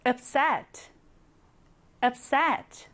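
upset said clearly as AP-set; model pronunciation. Shown as a demonstration. Teacher modelling the word upset for students. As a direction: say upset slowly, stress on the first part. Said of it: "얘도 tired만큼이나 자주 나오는 단어인데요".

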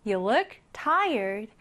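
slow, clear English model sentence; slow model reading. Shown as a demonstration. Teacher reading the example sentence slowly to students. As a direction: read it out slowly for the learners. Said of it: "너는 피곤해 보인다".

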